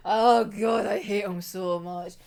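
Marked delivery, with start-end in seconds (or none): none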